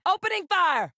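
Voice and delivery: high-pitched battle cry; combat callout. Acted. Crew member reporting an attack, urgent high shout. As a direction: call it out over a fight.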